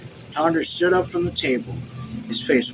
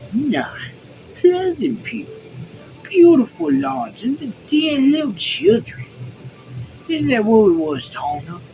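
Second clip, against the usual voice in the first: mocking imitation; 10 semitones higher, 4 dB louder than usual. Officer apes Tanner's dream of farms, high mocking imitation. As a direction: mimic him mockingly, pitched way up. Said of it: "he imitated Tanner's voice".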